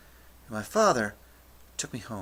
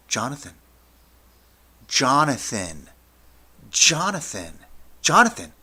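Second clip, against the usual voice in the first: name repeated louder and higher each time; escalating teacher's call; 4 semitones higher, 9 dB louder than usual. Retold or imitated. History teacher calling to a laughing student, mounting shouts. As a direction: build from firm to a high shout.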